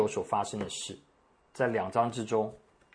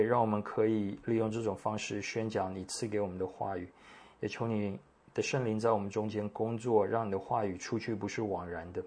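hushed, softer praying voice; prayer; 3 semitones lower, 6 dB quieter than usual. Real, not acted. Pastor asks God to bless the teaching time, quiet prayer. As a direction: pray quietly and reverently to the Father.

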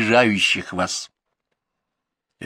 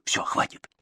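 urgent whisper; 7 dB quieter than usual. Acted. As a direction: whisper it to stop her. Father hushing the mother.

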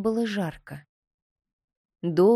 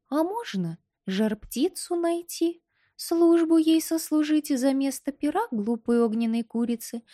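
high, light little doll voice; 5 semitones higher than usual. Acted. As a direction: speak as a small pert doll, pitched up. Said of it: "doll character speaking".

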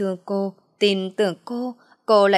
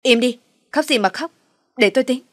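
higher, louder curt command; impatient, raised-voice scolding; irritable snapping; 4 semitones higher, 5 dB louder than usual. A narrator's reading. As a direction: shut down the crying sharply. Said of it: "cằn giọng nói với bà Loan".

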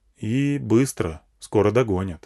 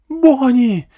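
piercingly high, loud cry; frantic mother's wail; woman's scream; 9 dB louder than usual. A narrator's reading. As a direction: scream your son's name in alarm. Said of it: "завопила женщина".

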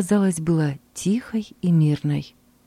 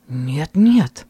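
decisive; 2 semitones lower, 3 dB louder than usual. Acted. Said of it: "решительно ответил муж".

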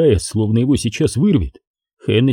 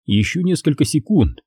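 usual speaking voice

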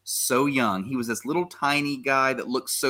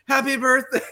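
high, louder mock shout of happy birthday; high cheering crowd imitation; surprise party cheer; 12 semitones higher, 5 dB louder than usual. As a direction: yell it like the whole crowd jumping out.

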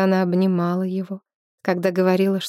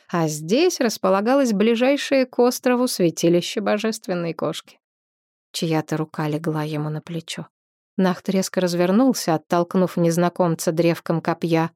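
plain reading voice

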